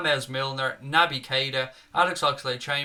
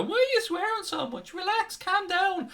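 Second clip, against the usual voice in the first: much higher, slow, prim nagging voice; mocking complainer. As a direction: parody the viewers who tell him to calm down. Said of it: "we always get people in go".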